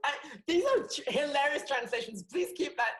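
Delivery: high, loud, laughing delight; real laughter. Real, not acted. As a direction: laugh through the words. Laughing at bad translation.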